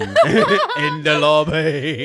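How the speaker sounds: loud, drawn-out British parroting; mock British accent. Mimicking the lady on the phone, mock British accent. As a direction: stretch it out high in a hammed-up British accent. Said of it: "doing it in a British accent".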